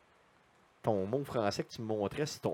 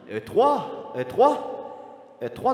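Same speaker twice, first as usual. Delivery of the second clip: repeated higher and louder like an echo; canyon echo; 10 semitones higher, 5 dB louder, much slower than usual. Pretend. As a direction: bounce the words back like a canyon. Vocal echo of the title.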